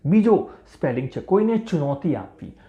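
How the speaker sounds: ordinary talk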